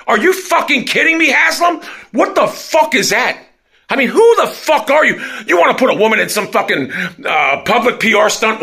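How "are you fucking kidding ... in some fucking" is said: higher, yelling at the owner in disbelief; furious shout. Real, not acted. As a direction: blow up at Haslam, really shouting. Yells at the team owner.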